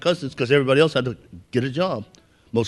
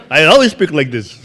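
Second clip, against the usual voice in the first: louder, laughing through the protest; 9 dB louder than usual. Retold or imitated. Teen told to lose his accent, laughing protest. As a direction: laugh while insisting you talk normally. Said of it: "So I said".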